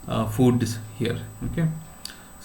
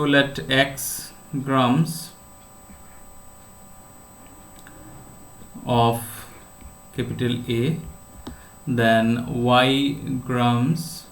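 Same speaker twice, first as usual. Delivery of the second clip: dictating; slower than usual. Real, not acted. Teacher dictating notation to students.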